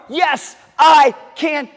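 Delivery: slow, high, very loud shout; final big shout. Shown as a demonstration. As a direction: belt out the last one slowly. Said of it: "One more".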